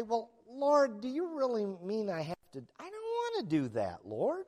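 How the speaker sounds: mock-whine your excuses at God.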